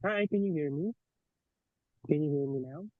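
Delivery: usual speaking voice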